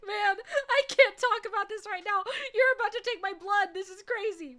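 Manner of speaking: high, loud panicky protest; hammed-up frantic pleading; retold panic. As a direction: act out freaking out at the needle. Acts out her panic at blood draw.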